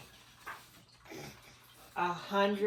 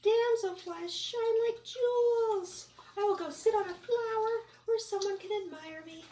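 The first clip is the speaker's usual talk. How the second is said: high vain bug; 4 dB louder than usual. Acted. Bug character voice.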